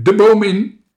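high, loud command; urgent shout. A narrator's reading. Captain sends friends climbing from the lion, urgent shout. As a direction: shout them up the tree. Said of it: "riep de kleine kapitein".

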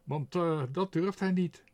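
high, loud mocking taunt; jeering. A narrator's reading. The sparrow Toep taunts the crow, loud jeering voice. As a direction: tease the crow openly. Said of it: "jouwde Toep".

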